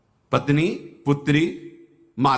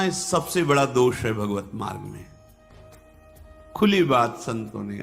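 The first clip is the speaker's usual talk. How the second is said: ordinary talk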